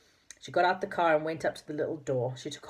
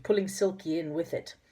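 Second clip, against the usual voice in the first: ordinary delivery, nothing marked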